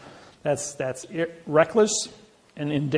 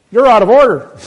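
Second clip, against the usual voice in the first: higher, louder, booming rebuke from the bench; the judge slams back, louder and higher; judge's retold shout; 8 semitones higher, 16 dB louder than usual. The judge shouting the speaker down, loud, high rebuke. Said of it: "the judge perks up and says".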